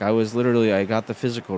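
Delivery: ordinary talk, nothing marked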